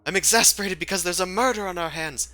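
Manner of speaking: high-pitched indignant outburst; exasperated retort. Acted. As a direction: answer back in exasperation. Character retorts to being called exasperated.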